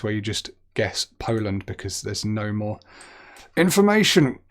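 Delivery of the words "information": mock dramatic. Real, not acted. Comic emphasis on dead end.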